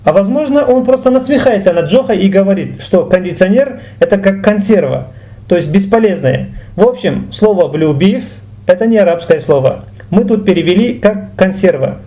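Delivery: ordinary talk, nothing marked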